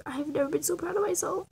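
ordinary talk, nothing marked

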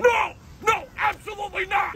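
high, outraged shout; angry dad refusal. Acted. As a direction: shut the request down hard. Dad angrily refuses the request.

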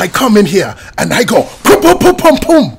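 put-on loud comic African accent with percussive nonsense; hammed-up African-accented boasting. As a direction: punch out the pum-pums in mock African accent. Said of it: "I show you my common tongue".

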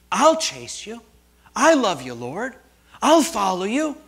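loud, high, fervent vow; acting out an emotional pledge to the Lord; pleading cry, retold with feeling; almost in tears. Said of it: "he's almost in tears".

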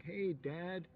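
put-on high squeaky child saying Hey, Dad; mock a little kid greeting Santa; child's greeting. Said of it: "children coming up to me and going".

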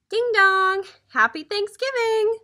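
high, loud, singsong announcement; cheery doorstep greeting. A narrator's reading. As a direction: chime the doorbell, then greet merrily. Disguised Turkey at the door.

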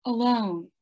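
normal talking voice

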